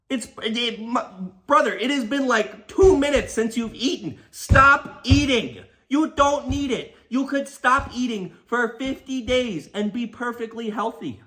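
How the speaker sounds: high-pitched, pleading rant; exasperated scolding. Acted. Storyteller telling the passenger to stop eating, exasperated scolding.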